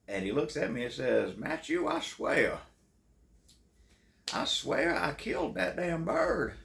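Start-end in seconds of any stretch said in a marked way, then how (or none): 1.4-6.5 high, slow, drawn-out Southern planter voice; Southern plantation-owner accent. Retold or imitated. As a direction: swear it in a thick old-Southern-gentleman accent. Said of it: "sounded like he owned a plantation".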